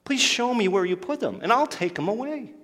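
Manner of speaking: high, imploring voice; pleading. Acted. Mary begging the supposed gardener for the body, pleading. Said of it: "she pleads with him".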